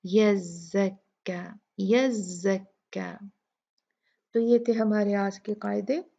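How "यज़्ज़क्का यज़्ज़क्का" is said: यज़्ज़क्का with a held doubled z, slow. Shown as a demonstration. Teacher modelling the shadda, slow. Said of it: "हमने यहाँ भी वैसे ही पढ़ना है".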